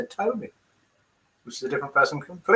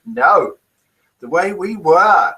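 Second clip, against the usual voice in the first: ordinary talk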